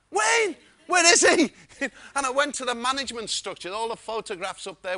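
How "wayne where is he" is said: loud, high, eager shout; excited calling. Retold or imitated. Guest rushes downstairs looking for Wayne, excited high calling. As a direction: call out high, hunting for someone.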